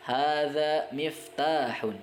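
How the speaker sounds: Hadza masjidun drawn out slowly and clearly; teacher modelling Arabic slowly; slow model reading. Shown as a demonstration. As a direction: read it slowly for students to repeat. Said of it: "kurang lebih contohnya".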